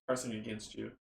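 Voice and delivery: usual speaking voice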